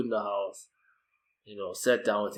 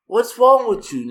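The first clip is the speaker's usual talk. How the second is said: snapped question, much higher; loud, high retort; 11 semitones higher, 10 dB louder than usual. Retold or imitated. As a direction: demand it loudly. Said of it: "neighbour reacting".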